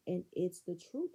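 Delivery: ordinary talk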